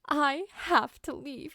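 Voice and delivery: put-on little boy leaving home in tears; tearful boy. A narrator's reading. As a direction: choke out the words through tears. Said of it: "he said quietly through his tears".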